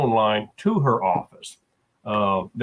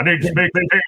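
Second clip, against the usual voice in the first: gruff male voice; 7 semitones higher, 5 dB louder than usual. Retold or imitated. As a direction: growl it like a gruff caller. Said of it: "a very gruff male voice says".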